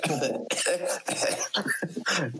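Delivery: mock choking noises, high-pitched; imitated choking. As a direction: act out choking on food, then laugh. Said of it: "I started choking on a piece".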